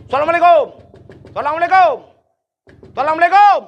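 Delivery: loud greeting at the door. Acted. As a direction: call out the salam loudly at the door. Knocking at the door at midnight. (memberi salam dengan lantang di depan pintu)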